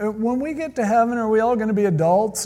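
lightly voiced child asking; little kid. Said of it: "a little kid ... asked me".

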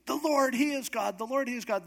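high, awed chanting cry; excited crowd. Retold or imitated. People declaring after the fire falls, high and excited. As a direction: proclaim it like a roused crowd. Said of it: "they're so excited".

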